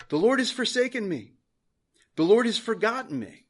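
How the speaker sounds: high, loud cry of complaint; forsaken lament. Acted. Preacher quoting Zion's complaint against the Lord, high plaintive cry. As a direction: cry out as one forgotten, pitched high. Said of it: "but Zion said".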